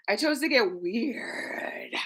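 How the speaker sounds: a slow secret whisper. Shown as a demonstration. Host repeats her line to viewers as a slow whisper. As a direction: breathe it out slowly with no voice.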